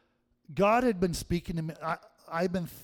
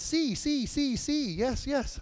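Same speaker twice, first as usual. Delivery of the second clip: much higher, chirpy repeated see; eager South American believers; 10 semitones higher, 4 dB louder, slower than usual. Retold or imitated. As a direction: mimic an eager crowd answering yes yes. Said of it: "they said".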